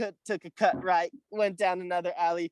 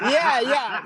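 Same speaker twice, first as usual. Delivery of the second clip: high, loud mock Irish "yeah, yeah"; Irish accent imitation; 10 semitones higher, 6 dB louder than usual. Imitating the Irish owner, a loud mock Irish yeah. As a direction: ham up the old Irish boss agreeing. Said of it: "He's like".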